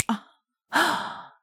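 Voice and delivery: high breathy moan. Acted. Aroused moan during climax.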